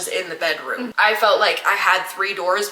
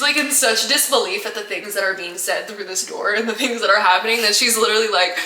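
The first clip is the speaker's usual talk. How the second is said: ordinary talk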